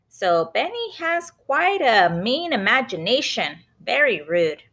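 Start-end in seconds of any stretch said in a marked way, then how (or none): none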